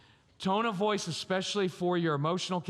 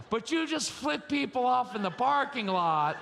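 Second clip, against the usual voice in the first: higher-pitched mock shouted protest; 6 semitones higher than usual. Mock child protesting to parent.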